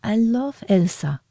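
mildly exclaimed declaration of love. A narrator's reading. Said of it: "he exclaimed".

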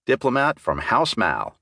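plain reading voice

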